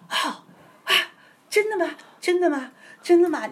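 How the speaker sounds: high, rising cries of wonder; a woman suddenly believing, high and amazed; amazed disbelief. Retold or imitated. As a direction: gasp out each question, astonished. Amazed sick woman hearing testimony.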